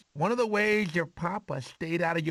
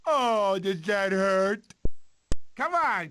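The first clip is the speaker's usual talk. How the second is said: ordinary delivery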